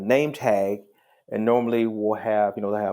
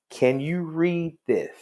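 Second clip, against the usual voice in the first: higher, slow and deliberate; very emphatic; 8 semitones higher, slower than usual. Retold or imitated. Judge showing the young man his badge, very emphatic. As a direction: spell it out word by word, retelling your own pointed question. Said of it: "now I'm very emphatic".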